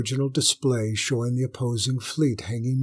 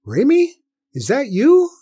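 much higher, tentative caller; tentative woman's voice; 13 semitones higher, 8 dB louder than usual. A narrator's reading. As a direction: ask shyly over the line. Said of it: "a tentative voice came from the speakers".

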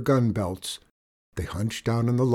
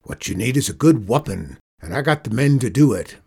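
gruff cowboy threat, getting louder; menacing outlaw boss; 6 dB louder, faster than usual. Acted. Outlaw boss threatening the boy, mildly menacing. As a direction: promise the kid a licking.

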